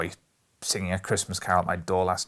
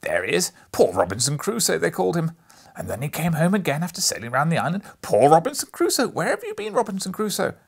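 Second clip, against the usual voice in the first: excited; 8 semitones higher, 8 dB louder, faster than usual. A narrator's reading. Scrooge reliving childhood stories.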